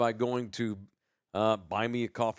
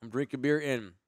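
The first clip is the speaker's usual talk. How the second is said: chewing while talking; mouth full. Real, not acted. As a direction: talk through a mouthful of chips. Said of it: "Trying to talk through chewing".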